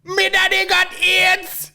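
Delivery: slow, very high mock Jamaican greeting; squeaky Jamaican patois, drawn out; hammed-up Jamaican accent. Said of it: "He's at parties like".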